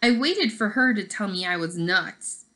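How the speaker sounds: ordinary delivery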